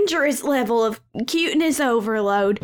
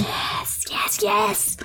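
whispered yes; 3 semitones higher, 4 dB quieter, much slower than usual. Real, not acted. Whispering yes over cute cat.

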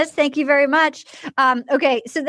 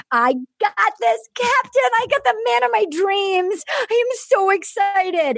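overjoyed gushing; 7 semitones higher than usual. Retold or imitated. Bride-to-be thanking the captain, overjoyed gushing. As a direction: gush it in a hammed-up higher voice. Acting woman's joy over engagement.

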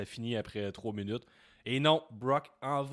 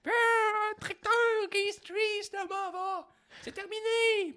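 very high, squeaky mock Brock voice; hammed-up tiny voice of a big wrestler; Brock Lesnar impression; 5 dB louder, slower than usual. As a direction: Brock quitting unless he gets a tractor, squeaky. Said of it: "sa petite voix de Brock".

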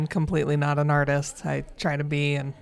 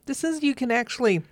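higher-pitched, emphatic thrill; retold delight; 6 semitones higher, 3 dB louder than usual. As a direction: relive the delighted discovery. Retold delight at exhibitor lounge.

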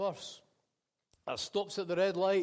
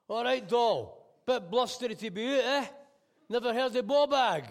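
raised, higher gruff Scots banter; loud cabbie patter; 6 semitones higher, 9 dB louder than usual. Acted. Cabbie greeting his soaked fare, loud and cheeky. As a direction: call it out over the rain, cheeky.

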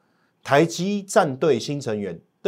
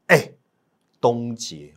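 usual speaking voice

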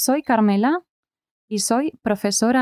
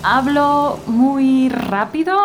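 slow, careful teacher speech; deliberately slowed words; 4 semitones higher, slower than usual. Acted. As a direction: spell it out slowly for the class. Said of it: "¿Puedo hablar más despacio?".

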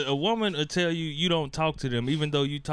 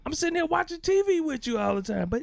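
exasperated; 11 semitones higher than usual. Real, not acted. Exasperated rant about girlfriend.

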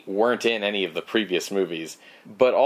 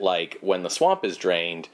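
ordinary delivery

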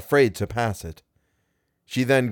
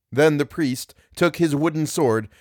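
plain reading voice

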